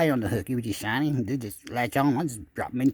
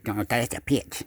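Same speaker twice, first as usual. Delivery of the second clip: ordinary talk, nothing marked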